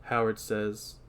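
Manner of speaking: ordinary delivery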